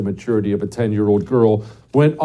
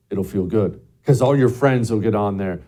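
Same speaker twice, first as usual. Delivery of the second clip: usual speaking voice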